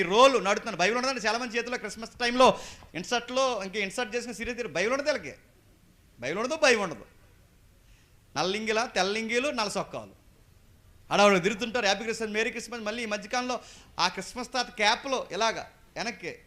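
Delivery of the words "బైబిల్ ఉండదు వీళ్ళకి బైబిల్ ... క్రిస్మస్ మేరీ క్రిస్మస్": normal talking voice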